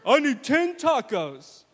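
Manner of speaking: mock hungry pastor; hungry dad. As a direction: drag out the taco request. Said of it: "He said".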